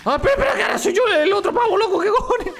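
very high, frantic squeal; real gamer panic; panicked surprise. Real, not acted. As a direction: blurt it out in a high-pitched rush. Surprised mid-fight by controlling other character.

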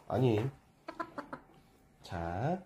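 ordinary talk

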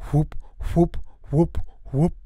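propeller whoop. Acted. Propeller noise imitated.

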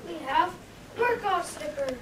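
upbeat commercial voice selling slippers; ad read. Acted. Shoe store ad selling slippers to listeners, upbeat pitch. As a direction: pitch it like a radio spot.

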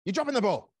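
accusing outburst; angry boss. Retold or imitated. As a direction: boss snapping at an overloaded worker. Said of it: "But if your boss came out and you said".

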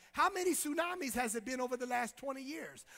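ordinary delivery

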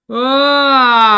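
high, loud frustrated yell; a loud raging ah; angry shout. Real, not acted. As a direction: let out a furious cry. Frustrated outburst.

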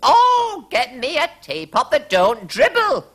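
very high, loud wailing queen; crying queen. A narrator's reading. Queen begging her servants for a teapot, high wailing cry. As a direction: wail it like a desperate old queen. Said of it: "cried the Queen".

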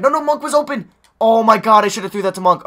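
high, loud exasperated shout; frustrated yelling. Real, not acted. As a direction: yell at the missed pass. Frustrated at missed pass.

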